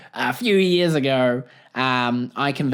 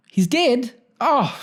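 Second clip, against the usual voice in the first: mock shock; 10 semitones higher, 3 dB louder, slower than usual. Naive New Zealander hears news.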